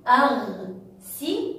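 slow, segmented أغسل; split into syllables. Shown as a demonstration. Teacher leading pupils in breaking it up, syllable by syllable. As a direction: cut أغسل into separate syllables with pauses. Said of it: "هيا معا لنقطعها".

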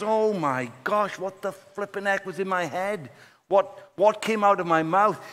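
high, rushed panic at his own promise; a man horrified at what he just said; panicked. Retold or imitated. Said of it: "he wants to pee himself".

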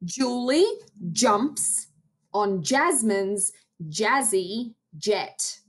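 slow, each word placed; slow careful tongue twister. Shown as a demonstration. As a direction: say it slowly and clearly. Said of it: "stand up and practice this one".

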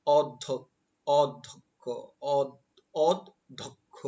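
অধ্যক্ষ broken slowly into "od - dhok-kho"; correct pronunciation demo. Shown as a demonstration. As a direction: sound out অধ্যক্ষ in slow, separated syllables. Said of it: "এটার উচ্চারণ হবে".

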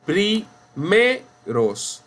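primeros split pri-ME-ros, high and very slow; syllable by syllable. Shown as a demonstration. Teacher sounding out primeros, stressed pri-ME-ros. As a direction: break primeros into pieces, punching ME. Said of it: "no decimos".

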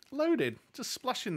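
hammed-up exclamation of loaded; excited tease. Real, not acted. Repeats the tease.

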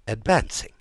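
rising incredulous echo; disbelieving question. Acted. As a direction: ask it back in disbelief. King repeats news in disbelief.

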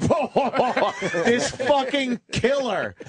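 high, mock-amused whoa; amused Barnes reaction. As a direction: imitate a tough guy tickled by a joke. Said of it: "he actually finds that so funny".

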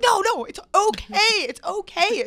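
high, loud hurried no no; calm him down in a loud panic; frantic reassurance. Retold or imitated. Girl reassuring the shocked man in a loud rush.

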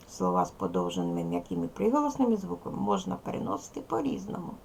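normal talking voice

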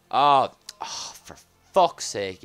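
loud, frustrated groan at the game. Real, not acted. Game error interrupts pack opening.

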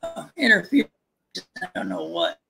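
choppy, dropping-out connection audio; glitchy call audio cutting out; voice breaking up. Real, not acted. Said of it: "your voice is breaking up".

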